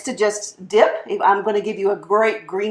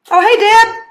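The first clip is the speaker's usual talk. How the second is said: bright, much higher and louder hello; surprised greeting; 13 semitones higher, 7 dB louder than usual. Real, not acted. Cook spotting a friend in the live chat, delighted surprise. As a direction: greet a friend who just showed up.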